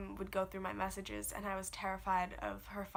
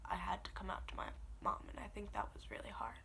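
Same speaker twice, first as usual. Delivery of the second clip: tearful whisper; 2 semitones lower, 8 dB quieter than usual. Real, not acted. Crying while telling coming out.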